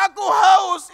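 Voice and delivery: high loud cry of thirst; shout. Acted. Jesus cries out on the cross. (teriakan keras "Aku haus")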